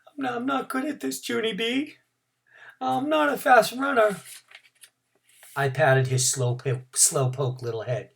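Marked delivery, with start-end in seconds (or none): 0.1-4.2 nervous secret. Acted. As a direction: admit it shyly into her ear. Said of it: "quick whispered a secret".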